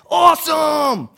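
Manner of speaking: high, loud, slow surfer-dude shout; Ninja Turtles voice. Retold or imitated. Disciples as Ninja Turtles, shouting high and drawn out. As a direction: cheer it like a cartoon turtle. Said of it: "sounded anything like the Teenage Mutant Ninja Turtles".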